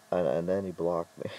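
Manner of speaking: ordinary delivery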